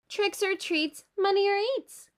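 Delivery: high, loud chant at the door; chanted kids' yell. A narrator's reading. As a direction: call out trick-or-treat. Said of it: "they yell at the first door".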